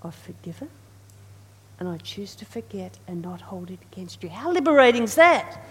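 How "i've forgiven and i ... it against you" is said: soft, tender voice of Jesus; gentle Jesus. Retold or imitated. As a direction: reassure quietly and kindly. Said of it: "But Jesus says".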